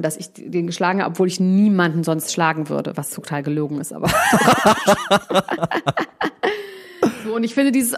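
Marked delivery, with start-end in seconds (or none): 4.1-6.7 high burst of laughter. Real, not acted. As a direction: burst out laughing, caught in a lie. Laughs at own admission. (lautes, hohes Auflachen)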